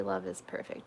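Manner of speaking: breathy whisper, voice dropped low; hushed whisper. Acted. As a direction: murmur it like a secret. Reading comment in hushed voice.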